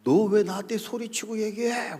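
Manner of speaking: acted indignant question; reproachful pastor. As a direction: imitate a pastor taken aback and accusing. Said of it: "갑자기 그래요".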